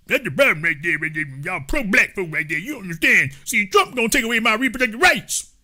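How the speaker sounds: garbled, excitable caricature; mock an incoherent know-it-all; mocked commenter. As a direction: slur the words together like a clueless ranter. Mocking caricature of a chat commenter.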